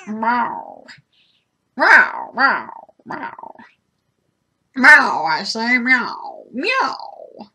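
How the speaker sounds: lightly mimicked cat meows; meowing. Meowing at her cat, lightly mock cat. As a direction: meow at the cat.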